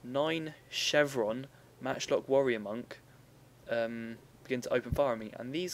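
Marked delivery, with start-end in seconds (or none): 0.0-3.0 slow, clearly articulated, every word spelled out; slow careful repeat. Shown as a demonstration. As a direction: say it again slowly and clearly for the listeners. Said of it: "let me just repeat that".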